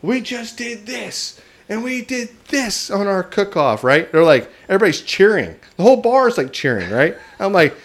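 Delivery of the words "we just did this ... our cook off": put-on drunk on stage.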